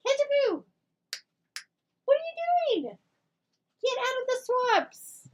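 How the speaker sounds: high playful doggy scolding. Real, not acted. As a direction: squeal the dog's pet name and scold it playfully. Calling the dog.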